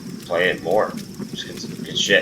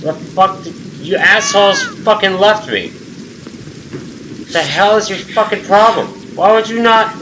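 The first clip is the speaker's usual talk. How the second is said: real exasperated rant, voice pitched up; indignant yell; 10 semitones higher than usual. Real, not acted. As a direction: chew out the teammates who abandoned you. Yelling at teammates who left him.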